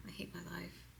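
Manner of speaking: breathy fast whisper of the phrase; soft quick repeated murmur; whispered mantra. Shown as a demonstration. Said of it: "I'm going fast".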